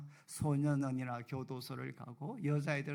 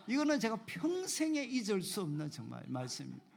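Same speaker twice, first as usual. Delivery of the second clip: ordinary talk, nothing marked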